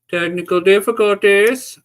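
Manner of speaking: high, loud put-on announcement; mock announcement. Streamer announcing trouble to viewers, mock announcement.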